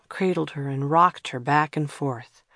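usual speaking voice

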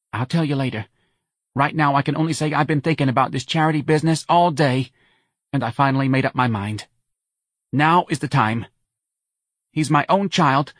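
high, earnest mother; Mrs. Riley, resolved. A narrator's reading. Mother deciding on treatment for her child, high and earnest.

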